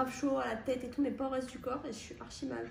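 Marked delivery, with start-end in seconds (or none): 1.9-2.7 voix éteinte et soufflée, presque sans son; voix morte. Real, not acted. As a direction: parle avec la voix cassée par la maladie. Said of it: "j'ai la voix complètement morte". (voix malade et épuisée)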